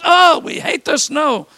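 exaggerated moan; mock whine. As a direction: grumble like a never-happy neighbour. Mock weather complaint.

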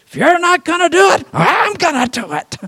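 put-on high, loud scolding old grandma. Grandma scolding a careless parent, high and sharp. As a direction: snap at the parent like a fed-up grandma.

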